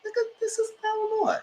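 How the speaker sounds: high, soft weepy voice; mock tearful. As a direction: fake a tearful whine. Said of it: "Naomi is like".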